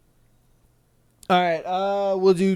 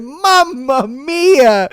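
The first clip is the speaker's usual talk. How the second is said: high, slow, loud Italian cry of mamma mia; Italian exclamation; 7 semitones higher, 8 dB louder, much slower than usual. Retold or imitated. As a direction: shout mamma mia like a shocked Italian guy. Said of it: "He said".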